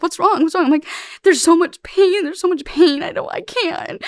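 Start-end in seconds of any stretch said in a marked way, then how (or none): 0.0-0.6 worried questioning. Retold or imitated. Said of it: "He's like". 1.2-4.0 much higher, slow, half-acted tearful pleading; retold crying plea. Recalling her arrest-night breakdown on tape, imitated tearful plea. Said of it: "Like, I'm crying".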